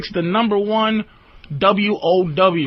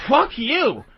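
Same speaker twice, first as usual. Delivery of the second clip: higher mock nagging girlfriend; imitating girlfriend; 4 semitones higher, much slower than usual. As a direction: toss it off like an annoyed girlfriend. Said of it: "like, eight times".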